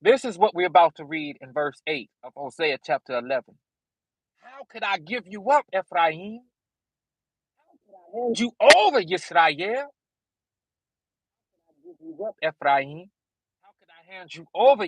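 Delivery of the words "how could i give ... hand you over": rising, searching plea on each question; pleading scripture reading. Acted. Preacher reading Hosea to congregation, pleading. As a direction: read it as God's aching plea.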